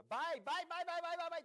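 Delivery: high, rapid buying chant; mock frenzy. Everyone starts buying on a misheard rumour, mock frenzy. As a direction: pile up the word in a pretend frenzy.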